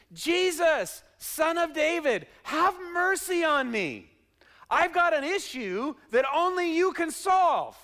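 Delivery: loud, high, drawn-out cry for mercy; shouting for Jesus. Acted. Blind beggar calling to Jesus as he passes, shouting. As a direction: call out slowly at full voice. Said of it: "he begins to shout for Jesus".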